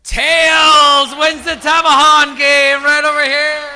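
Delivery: loud, bright winner call; winner announcement. Real, not acted. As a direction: proclaim the winner to the crowd.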